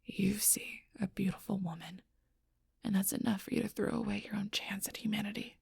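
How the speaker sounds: hushed, soft, wounded reproach; quiet hurt. Acted. Confiding betrayal to someone who doesn't understand, hushed and hurt. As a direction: drop to a near-whisper, with halting pauses. Hurt confession after the quarrel.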